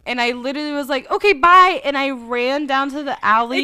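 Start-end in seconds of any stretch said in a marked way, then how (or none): 1.1-1.8 a sudden loud brush-off; abrupt loud goodbye. Retold or imitated. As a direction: blurt it out loud and sharp. Abrupt goodbye in retold story.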